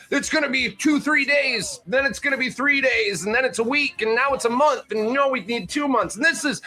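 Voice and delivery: high, mocking Trump impression. Host mocking Trump's war estimates, high mock voice. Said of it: "Trump fucking originally was like".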